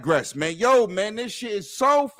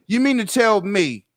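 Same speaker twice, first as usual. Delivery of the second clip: ordinary delivery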